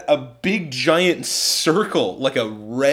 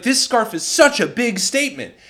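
high-pitched mock fretting; mimicked partner's complaint; 9 semitones higher, 3 dB louder than usual. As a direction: imitate partner fussing, pitched well up. Mimicking partner's complaint about scarf.

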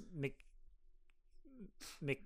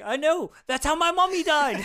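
high, loud mock wail; hammed-up anguished yell; distraught outcry; 12 semitones higher, 12 dB louder than usual. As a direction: shout it out like a distraught son. Acting the distraught son's outcry.

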